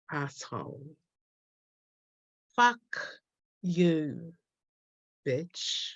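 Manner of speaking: slow, halting poem performance with long pauses; poem reading. Acted. Poet taunting James Cook in her poem, slow performed verse. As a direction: address it directly, phrase by phrase, letting each hang.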